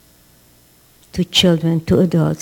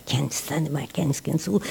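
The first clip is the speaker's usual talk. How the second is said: much softer and lower, grumbling; sullen kid muttering; 4 semitones lower, 10 dB quieter than usual. Retold or imitated. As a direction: mutter it like a bitter child. Resentful kid muttering in corridor.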